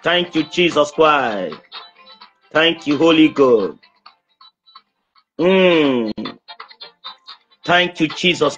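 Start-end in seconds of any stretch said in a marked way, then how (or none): none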